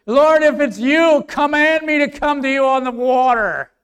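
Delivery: loud, high cry to the Lord; preacher's full-voiced Peter; bold Peter calling out. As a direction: call across the water as Peter.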